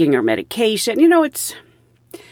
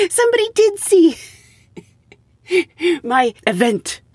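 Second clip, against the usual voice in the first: laughing through the words; real laughter breaking in; high laughing delight; 10 semitones higher than usual. Real, not acted. Laughing that someone saw.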